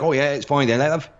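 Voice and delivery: a Scottish woman reassuring a customer, imitated; mock Scottish accent. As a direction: play the Scottish cashier calling him love, in a thick Scottish accent. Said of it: "it was just a thick Scottish accent".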